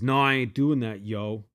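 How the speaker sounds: lightly imitating his Jamaican accent; a hint of the patois driver; mock patois refusal. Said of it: "And he says".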